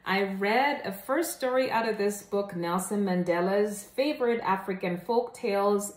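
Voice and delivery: ordinary delivery, nothing marked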